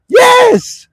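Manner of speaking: high, loud triumphant yes; emphatic shout. Acted. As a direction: shout it out like a winning answer. Emphatic answer to own question.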